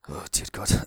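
ordinary talk

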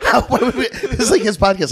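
normal talking voice

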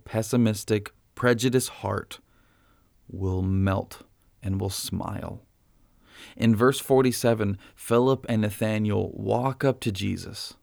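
ordinary talk, nothing marked

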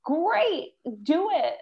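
high, delighted coach's cheer; enthusiastic encouragement. Retold or imitated. Breath coach welcoming a client's yawn, enthusiastic cheer. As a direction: urge them on with a high lilt.

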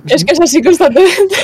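breaking into loud laughter; laughing. Real, not acted. Laughing at a friend's joke. (riéndose a carcajadas)

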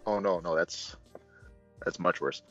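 ordinary talk, nothing marked

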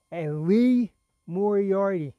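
loud, slow, drawn-out name call; all caps. Shown as a demonstration. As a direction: boom the name out like an announcer. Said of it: "I'm gonna say it in all caps".

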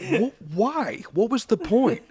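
high, baffled what-why; incredulous. Real, not acted. Ranting to friends about the pointless throw, high and incredulous. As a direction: throw up your hands in disbelief.

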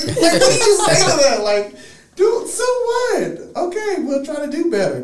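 high, loud, laughing disbelief; flustered disbelief. Retold or imitated. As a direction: sputter back in shock. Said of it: "I was like".

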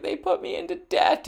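a high-pitched mock wail of the victim; mock distraught woman. Imitating the robbed woman, high and mock distraught. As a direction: squeak it up like the upset woman. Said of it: "she was just screaming and crying".

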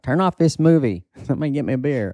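hammed-up Texas relative wanting a beer; mocking relatives. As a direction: brush it off like a bored uncle. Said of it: "They're like".

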